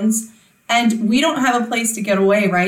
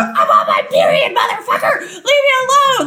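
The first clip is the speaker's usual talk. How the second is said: half-laughing mock shout, much higher; mock yell; 12 semitones higher, 3 dB louder than usual. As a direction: yell it at the teenager, cracking up. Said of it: "you don't look at an eighteen-year-old and go".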